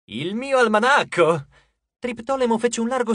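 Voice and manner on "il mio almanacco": slow, louder, higher joyful exclaim; delighted cry. Acted. God recognises his lost almanac, overjoyed. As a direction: gasp with delight.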